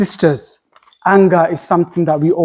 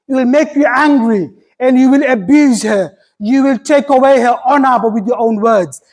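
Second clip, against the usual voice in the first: higher, building sermon voice; fervent preaching; 6 semitones higher than usual. Acted. Imam warning brothers and sisters about anger, preaching with fervour. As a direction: preach it up with rising urgency.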